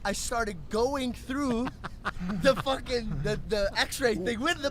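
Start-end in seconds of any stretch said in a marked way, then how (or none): none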